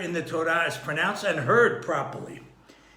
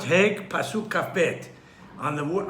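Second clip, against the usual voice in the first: usual speaking voice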